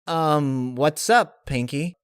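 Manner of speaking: trying to sound natural while confused; awkwardly casual. Acted. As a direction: Rainbow Dash greeting Pinkie awkwardly. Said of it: "tried to act natural".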